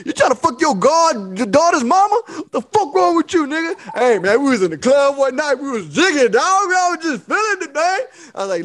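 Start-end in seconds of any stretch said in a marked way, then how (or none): 0.0-3.8 mock angry accusation. Acted quarrel over the mother. 4.0-8.0 sheepish excuse. Retold or imitated. Friend excusing himself.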